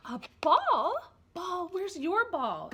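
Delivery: loud, high, slow and wondering; excited baby talk. Shown as a demonstration. Parent showing baby the ball, in excited baby talk. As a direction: make the ball sound thrilling for baby. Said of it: "Maybe on this page you say".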